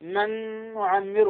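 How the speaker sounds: "من نعمره" with a nasal hum on the merged ن; Ghunna-Demo. Shown as a demonstration. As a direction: run "من نعمره" together, ن humming in the nose. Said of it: "هذه الغنة".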